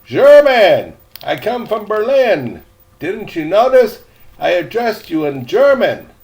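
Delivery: put-on high and loud, insistent girl; indignant correction. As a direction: set him straight firmly. Girl correcting him, annoyed.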